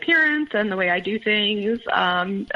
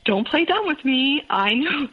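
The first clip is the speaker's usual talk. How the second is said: higher-pitched mock accusing tone; retold accusation; 6 semitones higher than usual. Jealous partner challenges her denial at dinner, retold accusation.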